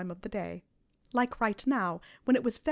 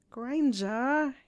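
louder, higher, drawn-out whine; whining; 4 semitones higher, 4 dB louder than usual. A narrator's reading. Boy pleads with his girlfriend, whining. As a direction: whine her name in protest. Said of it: "he whined".

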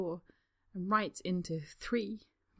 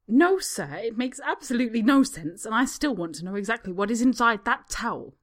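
louder sharp rebuke; snapping; 4 semitones higher, 8 dB louder than usual. A narrator's reading. Spa woman snapping at Harry and demanding the towel. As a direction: cut him off sharply. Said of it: "the woman snaps".

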